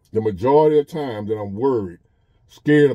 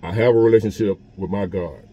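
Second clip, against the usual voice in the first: usual speaking voice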